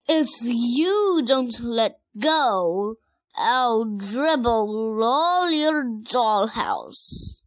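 loud, very slow, drawn-out roar. Acted. Rex threatening his twin over the truck, roaring. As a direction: roar each word slowly as a threat. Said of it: "roared Rex".

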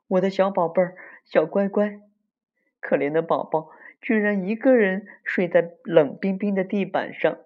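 high-pitched fussing mom voice; tender, high motherly lament; doting mother. Acted. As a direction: coo with pity over your sleeping little one. Mother pities her sleeping child.

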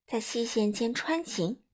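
ordinary delivery, nothing marked

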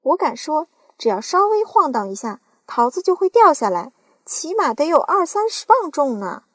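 loud, eager aunt voice; greedy aunt sure of the peach; excited aunt. Acted. Aunt excited about falling peach.

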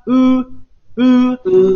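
乌 said high with rounded lips, held; Japanese u demo. Shown as a demonstration. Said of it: "还记得乌吗".

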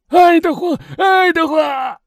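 grief-stricken, very high loud cries, last one drawn out; crazed screaming. A narrator's reading. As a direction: scream the child's name madly. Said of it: "疯狂地喊着". (疯狂悲痛地拖长声呼喊)

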